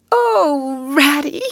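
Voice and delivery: high, loud, anguished wail; dismal cry. Acted. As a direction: cry it out in misery. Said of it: "he cried dismally".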